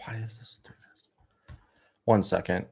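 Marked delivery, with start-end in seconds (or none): 0.1-1.6 whispered under breath; whispering. Real, not acted. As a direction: mutter it in a whisper. Frustrated muttering at computer.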